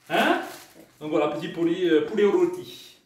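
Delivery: ordinary talk, nothing marked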